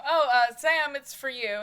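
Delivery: loud call into the house. Acted. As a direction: raise your voice to fetch someone. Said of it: "yelling, you know".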